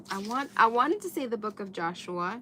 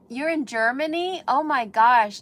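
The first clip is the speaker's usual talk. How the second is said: much louder delighted exclamation; excited surprise; 4 semitones higher, 9 dB louder than usual. Real, not acted. Thrilled that a viewer watches from Germany, loud delighted gasp. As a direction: burst out, thrilled.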